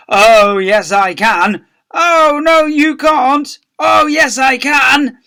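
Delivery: loud, high sing-song argument; pantomime back-and-forth. A narrator's reading. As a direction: shout each retort like panto. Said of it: "This pantomime routine went on".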